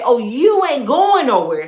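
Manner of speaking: slow, loud and firm; drawn-out warning to a husband who wants to leave; defiant grandma. Retold or imitated. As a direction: lay down the law like an old-school wife. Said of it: "the women back then said".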